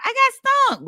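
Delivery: high, loud mock wail; hammed-up high-pitched complaint; stung child's protest. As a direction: whine it high like a kid explaining. Voices the stung child's excuse.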